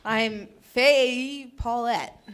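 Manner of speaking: drawn out, very slow name; laughing slow-motion name; slow mock self-intro. Shown as a demonstration. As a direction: savor each syllable of Faye Paulette. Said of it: "I don't know if that's how I say it".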